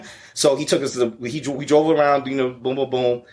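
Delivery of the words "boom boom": ordinary talk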